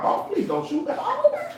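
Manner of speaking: high, pleading voice; a squeaky mock plea; mock plea to a cop. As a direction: beg the officer not to shoot, hammed up.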